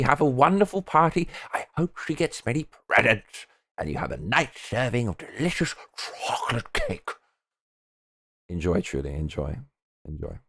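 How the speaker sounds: a hint of a trembling old man. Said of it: "the old man voice".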